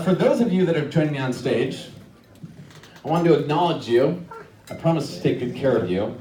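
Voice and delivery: usual speaking voice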